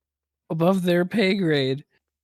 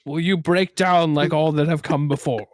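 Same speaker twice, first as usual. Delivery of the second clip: mock-solemn interrogator; 2 semitones higher, 5 dB louder than usual. Store worker to a scared child, mock-solemn booming voice. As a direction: intone it like a grand ominous being.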